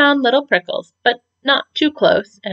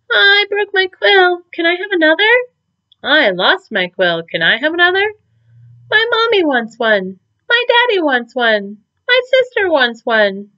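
put-on higher, louder eager kid voices; children begging for quills; kids clamoring; 9 semitones higher, 4 dB louder than usual. A narrator's reading.

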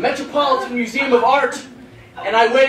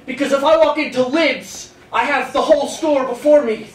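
ordinary talk, nothing marked

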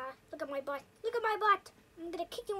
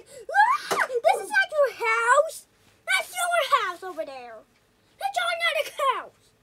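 panicked yelling; 6 semitones higher, 8 dB louder than usual. Real, not acted. Chased yelling at neighbor.

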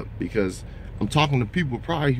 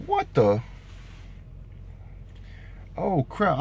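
startled; 5 semitones higher, 5 dB quieter, slower than usual. Real, not acted. Sees bad hours on log.

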